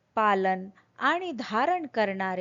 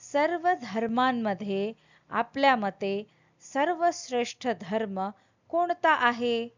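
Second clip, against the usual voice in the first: ordinary talk, nothing marked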